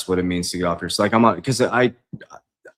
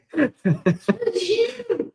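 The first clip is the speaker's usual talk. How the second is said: very high, open real laugh; big laugh. Real, not acted. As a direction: burst out laughing. Laughing at friend and fly.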